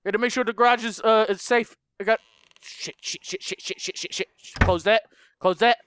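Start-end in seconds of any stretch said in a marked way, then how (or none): 2.6-4.3 softer, breathless repeated curses under the breath; whispered panic. Real, not acted. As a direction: panic quietly so nothing hears.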